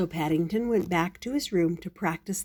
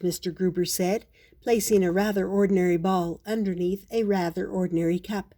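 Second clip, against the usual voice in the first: usual speaking voice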